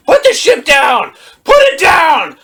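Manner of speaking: very high, loud yell; hammed-up frantic demand; mock screamed order. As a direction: shriek the command like a hysterical hero. Acts out Anakin's screamed order.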